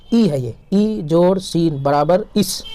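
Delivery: "ای جوڑ سین برابر اس" spelled out slowly; teacher spelling slowly for pupils. Shown as a demonstration. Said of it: "یعنی ای کے ساتھ سین کو ملا دیا". (آہستہ ہجے)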